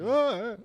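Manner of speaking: loud, high yelp of terror; mock scream on waking in fright; imitated startled scream. Retelling roommate waking to a fireball, loud imitated scream. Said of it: "and he was like".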